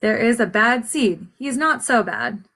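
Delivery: usual speaking voice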